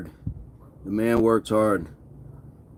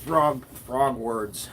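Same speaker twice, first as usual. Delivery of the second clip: slow frog words; 5 dB quieter, much slower than usual. Pretend. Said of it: "Stark has me out here saying".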